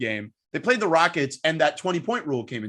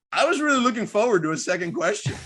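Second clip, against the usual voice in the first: amused retold deadpan; dry joke laughing; 6 semitones higher than usual. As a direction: retell the punchline laughing. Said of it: "he goes".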